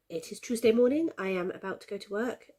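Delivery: normal talking voice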